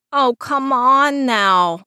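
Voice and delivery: slow put-upon whine; whining. Acted. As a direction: whine the complaint out slowly. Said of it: "he whined".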